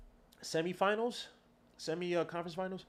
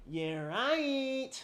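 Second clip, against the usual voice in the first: high mocking "yeah, right"; sneering sarcasm pitched way up; sarcastic scoff; 11 semitones higher than usual. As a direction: scoff at the idea. Sarcastic dismissal of rivals.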